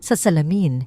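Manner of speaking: ordinary delivery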